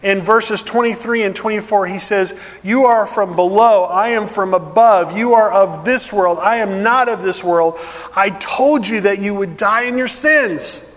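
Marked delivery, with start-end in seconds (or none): 2.6-10.8 ringing, emphatic sermon voice, a bit louder; preaching at full force. Acted. Preacher voicing Jesus' warning to the crowd, emphatic. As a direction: proclaim each line like a verdict.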